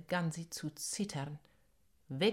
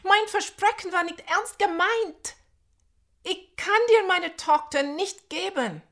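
desperate shouting; 13 semitones higher, 12 dB louder than usual. Acted. Nandi keeps pleading loudly.

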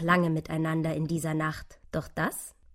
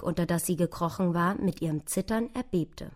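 usual speaking voice

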